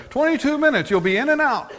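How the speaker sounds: high, loud advertising hook; promotional pitch. Acted. Church promoting its short service to newcomers, promotional pitch. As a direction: sell it big, high and loud. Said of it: "They promote it this way".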